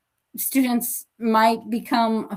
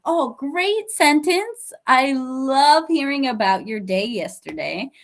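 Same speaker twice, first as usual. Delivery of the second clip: warm praise; 3 semitones higher than usual. Shown as a demonstration. Teacher praising a student before a small correction, warmly. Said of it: "praising with correction".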